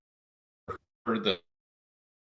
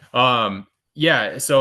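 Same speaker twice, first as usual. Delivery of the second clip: speaking up louder; 4 semitones higher, 9 dB louder, much slower than usual. Real, not acted. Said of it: "talk a little bit louder".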